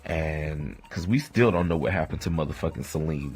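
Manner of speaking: usual speaking voice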